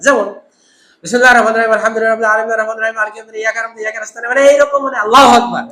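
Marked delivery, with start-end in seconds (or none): 1.0-4.2 gabbled Quran recitation, words blurred together; rushed recitation. Shown as a demonstration. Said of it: "এত দ্রুত পড়তেছেন যে পড়াটা বোঝা যাচ্ছে না". 5.0-5.6 rushed takbir. Shown as a demonstration. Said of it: "তারাবি পড়া একেবারে ফাইভ স্পিডে".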